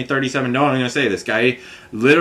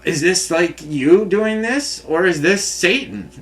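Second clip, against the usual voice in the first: higher, bewildered pleading questions; pleading to God; 6 semitones higher than usual. Retold or imitated. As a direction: plead upward, baffled and hurt. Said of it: "but I'm like".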